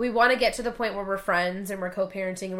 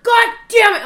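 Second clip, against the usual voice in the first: much higher, much louder mock outburst; imitating a furious curse; 13 semitones higher, 13 dB louder than usual. Wife retelling her husband swearing at the security tag, mock yell. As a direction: blow up like the husband did. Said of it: "he's just fucking pissed".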